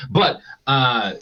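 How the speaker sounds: ordinary talk, nothing marked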